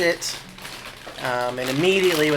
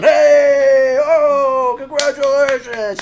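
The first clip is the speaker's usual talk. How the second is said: high, loud cheering crowd; 12 semitones higher, 11 dB louder, much slower than usual. Acted. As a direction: cheer the returning heroes. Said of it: "smiles and thumbs ups".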